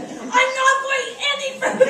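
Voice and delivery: high, loud re-enacted yell; vicious yelling. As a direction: yell down at the kids in fierce refusal. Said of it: "I'm yelling down at them like I'm vicious".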